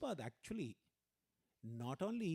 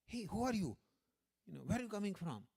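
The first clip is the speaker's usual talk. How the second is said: loud, higher-pitched eager questioning; excited calling out; 7 semitones higher, 4 dB louder than usual. Retold or imitated. Scholar rushing up to American boys, excitedly calling. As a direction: hail strangers with urgent curiosity.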